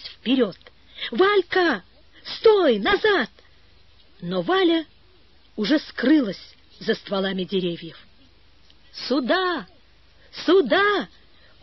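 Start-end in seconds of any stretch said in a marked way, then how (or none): 1.1-3.3 high-pitched cry to halt; storyteller voicing an alarmed shout; urgent calling out. A narrator's reading. Brother calls runaway sister back. 8.9-11.1 high, drawn-out summons; storyteller voicing a child's call; calling from afar. A narrator's reading. Girl calls brother from afar.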